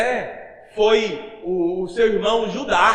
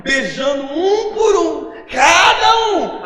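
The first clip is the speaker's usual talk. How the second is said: much higher and louder, slow crying preacher; impassioned cry; 8 semitones higher, 6 dB louder, much slower than usual. Real, not acted. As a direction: voice cracking high and loud, slowing on cada um. Preacher climaxing, voice breaking.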